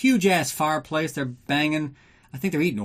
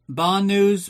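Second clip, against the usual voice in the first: louder and higher, fed up with being asked; exasperated outburst; 7 semitones higher, 5 dB louder than usual. Real, not acted. Host snapping at listeners who keep asking, exasperated. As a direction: blurt it out like you're sick of the topic.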